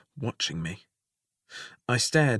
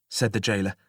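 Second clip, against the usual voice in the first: plain reading voice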